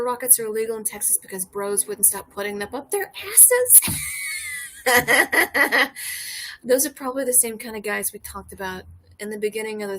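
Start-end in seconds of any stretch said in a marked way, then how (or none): 3.8-6.6 loud laugh. Real, not acted. Big laugh at own joke.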